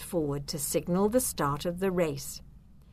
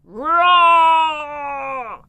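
long, loud, high storybook roar; dragon roar; 8 semitones higher, 10 dB louder than usual. A narrator's reading. Woken dragon behind the little witch, roaring. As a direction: roar it out, holding the sound. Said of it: "a terrible rumbling noise".

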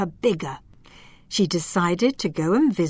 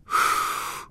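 relieved sigh. A narrator's reading. Fireflies sigh.

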